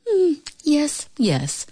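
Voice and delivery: high hum dropping to a cool assent; coolly self-assured, unimpressed. A narrator's reading. Pasha thinks over her stage role, coolly. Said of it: "thought Pasha coolly".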